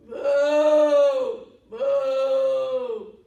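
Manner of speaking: loud mooing shout; cow moo. Pretend. Storyteller mooing loudly for the kids. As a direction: bellow like a cow. Kids' storytime, calling like cows.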